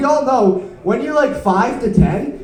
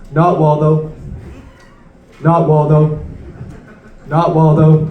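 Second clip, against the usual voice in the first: low, level announcement of each miss; failing searcher; 5 semitones lower, slower than usual. Acted. Man documenting his own failure in real time, low and monotonous. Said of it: "a man documenting his own failure".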